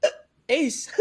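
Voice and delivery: fast, high mock ace call; hyped ace call. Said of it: "I'm gonna be like".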